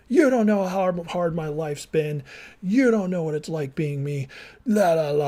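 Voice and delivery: hammed-up woe-is-me complaint; self-righteous whining. Husband mocking how he once defended himself to his wife, in a self-pitying whine. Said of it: "all this self-righteous B S nonsense. Woe is me crap".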